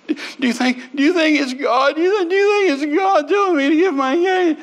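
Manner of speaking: very high mock wail, words tumbling over each other; imitated worsening sobbing. Wife poking husband about her engagement ring, imitated worsening sobbing. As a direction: wail the question in stuttering repeats, sending up her tears. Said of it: "she's getting worse".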